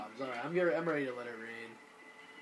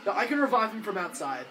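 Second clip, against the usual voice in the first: ordinary talk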